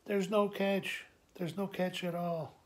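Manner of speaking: calm his worry tenderly; dad tenderly telling his son there's no catch; gentle reassurance. Retold or imitated.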